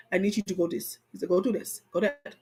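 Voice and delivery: low, soft imitation of husband's orders; giving directions. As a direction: quietly hand out instructions as the man in charge.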